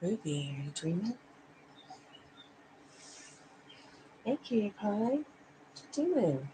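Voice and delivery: soft cooing. Real, not acted. Cooing to sleepy dog.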